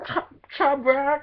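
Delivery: squealing clone hyping up its double; hyped clone voice. Pretend. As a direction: cheer yourself on like a giddy twin. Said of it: "then I would be like".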